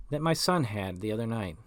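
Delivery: plain reading voice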